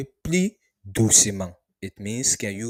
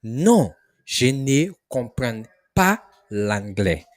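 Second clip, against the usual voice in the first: slow, louder Non je ne comprends pas; slow model answer; 4 dB louder, slower than usual. Shown as a demonstration. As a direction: model the refusal slowly. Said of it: "this is how you respond".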